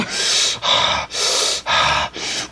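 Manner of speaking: mock heavy huffs of breath; exaggerated huffing and puffing. Imitating the fed-up library woman, huffing and puffing. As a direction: huff and puff in imitation of someone fed up. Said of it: "starts huffing and puffing".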